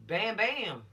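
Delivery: loud bam bam gunshot noises; mock gunshots. Teacher imitating a shooter firing, loud bam. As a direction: imitate gunfire with your voice.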